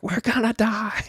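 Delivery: high, panicked wail; hammed-up fear of drowning; mock panic. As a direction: squeal it like a terrified sailor in a storm. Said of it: "they're freaking out".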